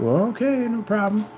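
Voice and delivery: high, sing-song brush-off; mock agreeable. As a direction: humour the doubters in a high voice. Mock reply to imagined trash talkers.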